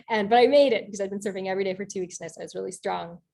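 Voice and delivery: plain reading voice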